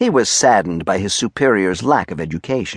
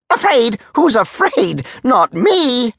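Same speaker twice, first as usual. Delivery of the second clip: indignant denial; 14 semitones higher, slower than usual. Acted. Major denying fear to his soldier, indignant and high. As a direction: shout it down in offended bursts.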